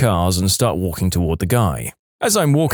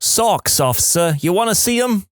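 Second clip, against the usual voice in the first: high, hammed-up fake Jersey accent; mock Jersey accent, pitched way up; cheeky fake New Jersey accent, high and playful; 8 semitones higher than usual. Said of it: "In my fakest Jersey accent".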